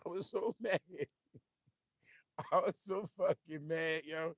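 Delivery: can't stop laughing while telling it. Real, not acted. Laughing, telling viewers how mad the fight made him.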